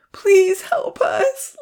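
high, slow, loud desperate plea; desperate call. A narrator's reading. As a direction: draw out a high cry for help. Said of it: "Lily called out desperately".

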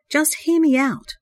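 a brother begs to be heard out, earnest plea; earnest begging. A narrator's reading. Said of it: "one voice begged earnestly".

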